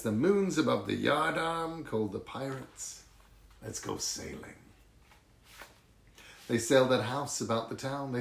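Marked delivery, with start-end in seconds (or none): 3.6-4.6 whisper. Acted. Pirates call, sly night whisper.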